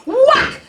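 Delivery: sharp loud high whack of a belt. Acted. Aunt's belt strikes the girl, loud whack. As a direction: crack it out like leather hitting skin.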